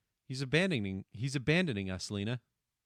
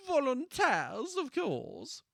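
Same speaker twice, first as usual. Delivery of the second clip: hammed-up posh British accent, higher and slow; mock British accent; 8 semitones higher, much slower than usual. Streamer redoing the line in a British accent. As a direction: Volunteers drawn out British style. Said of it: "one stereotypical accent to another".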